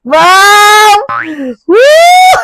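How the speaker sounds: high, loud whooping wow; thrilled cheer. Real, not acted. Vlogger switches on ambient light, thrilled cheer. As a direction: whoop with delighted amazement.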